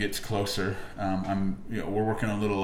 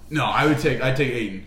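normal talking voice

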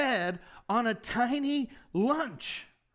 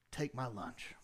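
whisper-quiet Take my lunch; hushed surrender; 12 semitones lower, 12 dB quieter than usual. Shown as a demonstration. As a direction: Take my lunch said very softly and low. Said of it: "posture of saying".

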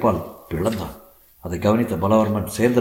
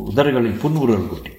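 plain reading voice

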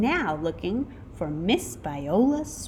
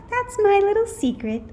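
high, louder playful reply; coy teacher; 13 semitones higher, 5 dB louder, faster than usual. A narrator's reading. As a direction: keep it teasingly secret, high-pitched. Returned sweet teacher answers coyly.

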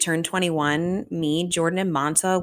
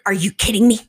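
a sharp, louder imitation of her friend snapping; 4 semitones higher, 5 dB louder, faster than usual. Driver friend snaps at drunk passengers, sharp mock anger. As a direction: snap it like the fed-up driver. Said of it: "snaps at us".

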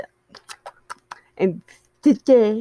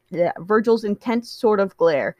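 ordinary delivery, nothing marked

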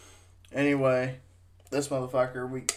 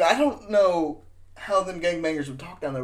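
ordinary delivery

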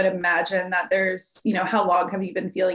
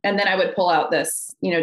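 ordinary talk, nothing marked

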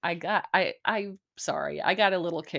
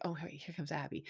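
soft aside; 4 semitones lower, 14 dB quieter than usual. Retold or imitated. Clayton noticing Gabby again.

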